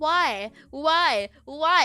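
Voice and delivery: put-on high, loud toddler-style why; pestering toddler. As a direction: keep demanding answers like a small child. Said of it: "explaining things to a toddler".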